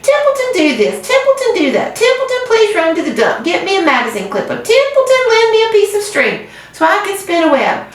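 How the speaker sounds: high sneering mimicry of the others; disgusted rat mocking. A narrator's reading. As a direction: whine out their demands sarcastically. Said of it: "he said in disgust".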